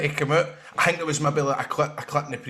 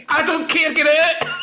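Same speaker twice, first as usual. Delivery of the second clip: high, loud mock stubborn mum; mum refusing; 14 semitones higher, 4 dB louder, slower than usual. Mum refuses on the phone, high and stubborn.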